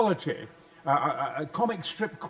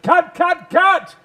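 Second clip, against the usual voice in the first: shouting cut; 11 semitones higher, 12 dB louder, much slower than usual. Retold or imitated. Director stops the take.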